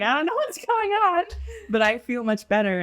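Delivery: very high, squeaky-high comic bewilderment; mock flustered. Cook answering the joking chef at the grill, mock flustered. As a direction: play up helpless confusion way up high. Acts out her own flustered reply.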